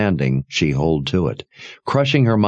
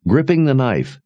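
plain reading voice

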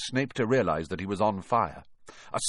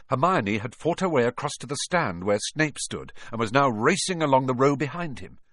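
ordinary delivery

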